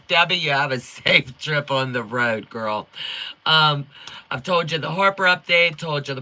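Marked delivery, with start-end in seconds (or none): none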